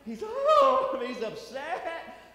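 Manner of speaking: high, loud, drawn-out mock wail; hammed-up distress. Pastor acting out the fourth dad's despair, mock wailing. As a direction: stretch it out in mock anguish. Telling of the crying dad.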